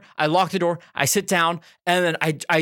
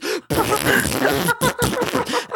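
squealing laugh; high laugh. Real, not acted. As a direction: burst into high giggles. Laughing mid-story.

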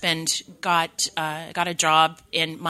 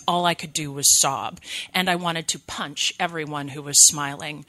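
ordinary talk

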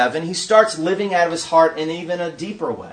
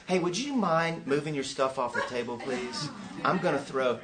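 soft, low, meek request; mock politeness; 5 semitones lower, 11 dB quieter than usual. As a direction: ask timidly, as a parody of being too polite. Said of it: "He didn't go".

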